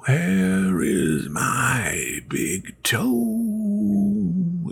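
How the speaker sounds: slow, drawn-out moan from far off; ghostly groan. Acted. Ghost in the street calling for its toe, long hollow groan. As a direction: stretch every word like a lost spirit calling. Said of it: "it groaned".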